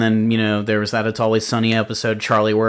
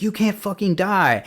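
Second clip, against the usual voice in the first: mildly frantic imitated plea; retold freak-out; 8 semitones higher than usual. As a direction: act out someone panicking, pitched high. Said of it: "he starts freaking out".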